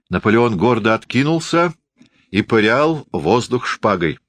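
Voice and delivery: usual speaking voice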